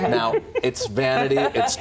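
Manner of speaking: amused joke delivery; laughing. Real, not acted. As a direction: laugh through the punchline. Laughing after his joke.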